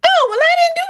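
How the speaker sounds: high, fast surprised denial. Real, not acted. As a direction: jump up high, caught off guard. Denies cabbage is mush.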